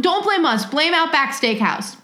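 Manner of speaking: ordinary talk, nothing marked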